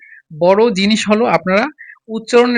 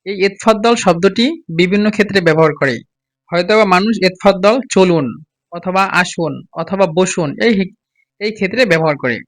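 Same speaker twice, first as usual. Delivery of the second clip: ordinary delivery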